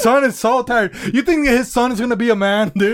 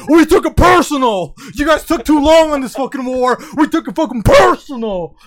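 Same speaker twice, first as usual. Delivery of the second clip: loud, raised voice war rant; mock war shout; 4 semitones higher, 5 dB louder than usual. Shouting at the enemy nations as a soldier. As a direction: bellow at the enemy countries.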